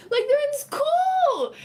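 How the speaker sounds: high, slow and loud exasperation; they're in school, said in shocked outrage; outraged. Real, not acted. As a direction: squeal it in disbelief. Repeating outraged point.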